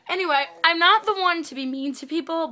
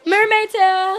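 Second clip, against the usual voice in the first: excited; 7 semitones higher, 8 dB louder, much slower than usual. Real, not acted. Showing off the mermaid tail.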